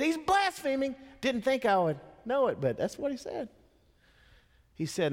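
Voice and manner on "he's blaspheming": much higher, scandalized "He's blaspheming"; accusing scribe. Retold or imitated. Preacher voicing the scribe accusing Jesus, scandalized high pitch. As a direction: voice a shocked, self-righteous scribe. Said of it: "All tough and big in his mind".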